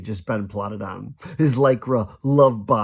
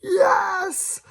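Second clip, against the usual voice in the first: harder scream. Acted. Crowd screaming harder for Flavio, very high shriek. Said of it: "screamed a little harder".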